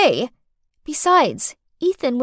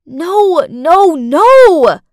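much louder, higher panicked screams; screamed; 6 semitones higher, 11 dB louder, much slower than usual. Acted. Stepmother screaming as she is blamed again, loud and high. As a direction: cry out in alarm. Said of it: "I screamed".

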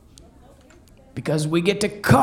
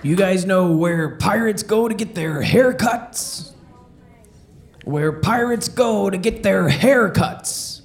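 ordinary talk, nothing marked

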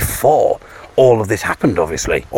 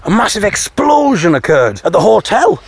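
higher, building excitement on explosion; retelling the shock twist in the soap, dramatic; dramatic reveal; 9 semitones higher than usual. Retold or imitated. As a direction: announce the twist like breaking news. Dramatic plot twist retold.

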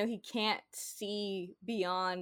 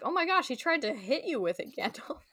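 shocked; 6 semitones higher, 3 dB louder than usual. Retold or imitated. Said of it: "Eomer's like".

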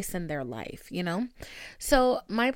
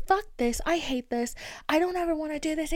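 dramatic outburst; 7 semitones higher, faster than usual. Retold or imitated. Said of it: "full dramatics".

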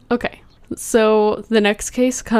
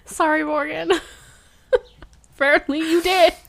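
high, giggly apology; laughing apology; 7 semitones higher than usual. Real, not acted.

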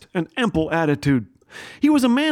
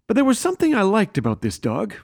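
usual speaking voice

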